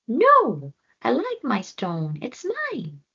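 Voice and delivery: put-on high child voice, firm refusal; Floki refusing. Floki refuses the hyena, high stubborn voice.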